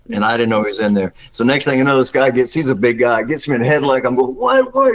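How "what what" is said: high, startled what what; a retold jolt of surprise; startled cry. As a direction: yelp it like you just got hit. Hit in the head, startled.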